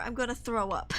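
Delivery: normal talking voice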